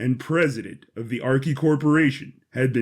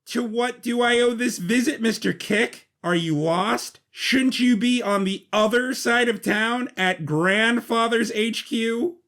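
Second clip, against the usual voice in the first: put-on higher, guarded boy's voice; young Arch I E, wary; 7 semitones higher than usual. Arch I E confronts Sidkick in the basement, higher wary voice. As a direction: size up the intruder coolly.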